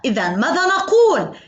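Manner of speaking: ordinary talk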